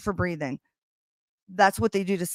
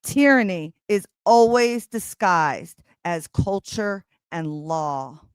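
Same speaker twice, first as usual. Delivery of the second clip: slow, weighty reading of a passage; solemn book reading; reading aloud; slower than usual. Acted. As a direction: read it like a grave pronouncement. Reads dramatic book passage aloud.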